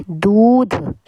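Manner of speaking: दूध with a long drawn oo; long vowel. Shown as a demonstration. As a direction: hold the oo in दूध. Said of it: "बड़े ऊ की मात्रा आएगी".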